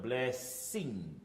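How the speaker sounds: "blessing" slow with a clear E vowel; open E sound. Shown as a demonstration. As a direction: stretch the E in "blessing". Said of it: "E sound".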